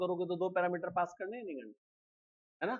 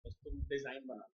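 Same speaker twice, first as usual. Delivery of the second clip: much softer, lower side conversation; quiet phone aside; 6 semitones lower, 13 dB quieter than usual. Real, not acted. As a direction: talk low into the phone, away from the class. Still on the phone call.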